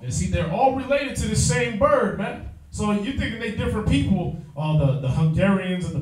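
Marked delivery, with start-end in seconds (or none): none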